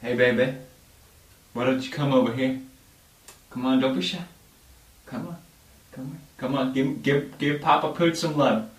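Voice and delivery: mock seductive. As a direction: coax them over like a cheesy lover. Seducing as Papa Pooch.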